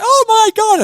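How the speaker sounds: mock fangirl shriek; excited squeal. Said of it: "they're like".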